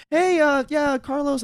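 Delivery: butter up the mom; fake polite kid. Friend to a mom at her door, high sugary politeness. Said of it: "was just like".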